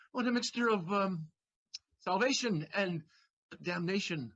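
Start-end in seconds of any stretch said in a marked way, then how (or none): none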